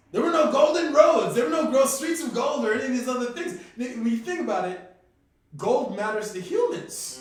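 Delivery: loud, high, emphatic argument; impassioned rant. Real, not acted. Man debunking golden streets of heaven, impassioned rant. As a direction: hammer the point home, loud and high.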